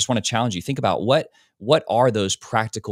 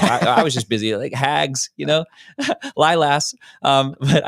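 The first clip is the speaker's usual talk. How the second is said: laughing, higher and louder; 5 semitones higher, 5 dB louder, slower than usual. Retold or imitated. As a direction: giggle through a mock yearbook voice. Mock yearbook signing, laughing.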